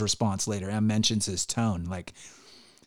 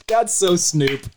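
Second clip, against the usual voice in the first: high, slow [name] drawl; [name] impression; 7 semitones higher, 5 dB louder, slower than usual. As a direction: mimic [name], louder. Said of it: "I'm like".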